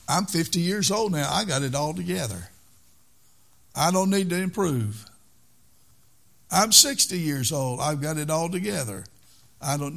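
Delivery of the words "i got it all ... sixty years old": ordinary delivery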